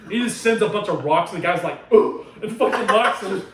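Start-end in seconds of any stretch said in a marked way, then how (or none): 1.9-2.3 loud mock grunt; comic "oof", punched out loud; grunt of impact. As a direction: grunt as if struck hard. Guy hit by the thrown rocks.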